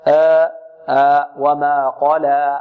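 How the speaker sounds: very slow وما قال with the alif stretched too long; overlong alif. Shown as a demonstration. Teacher showing students an overlong alif. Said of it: "لا نمدها أكثر من حركتين".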